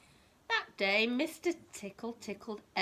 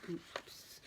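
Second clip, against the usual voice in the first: breathless unvoiced oops; whispered oops; 11 dB quieter than usual. Reading to a child, a whispered mock oops. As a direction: breathe a tiny oops of mischief. Reacting to dropped letters.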